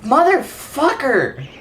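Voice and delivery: slow, high, drawn-out curse; angry. Real, not acted. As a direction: spit it out in real frustration. Enemies keep attacking.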